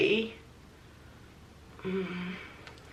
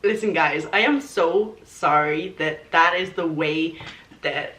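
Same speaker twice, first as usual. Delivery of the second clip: mock apology; 4 semitones lower than usual. Mock apology requested by friend.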